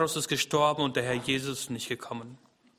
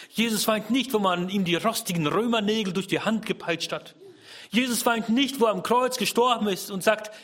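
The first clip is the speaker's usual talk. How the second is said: higher, louder insistent preaching; fervent preaching; 6 semitones higher, 4 dB louder than usual. Acted. Preacher stressing to listeners, fervent preaching. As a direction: drive the point home to the congregation. Preaching rising to full force.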